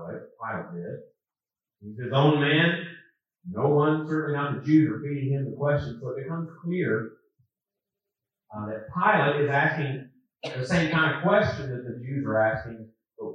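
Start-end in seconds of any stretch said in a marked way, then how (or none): none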